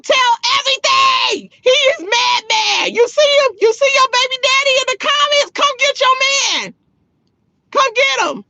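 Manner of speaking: high, hyped-up egging on; gleeful calling out. Real, not acted. Eggs on his baby mama in chat, gleefully hollering. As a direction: call to her in a high, teasing holler.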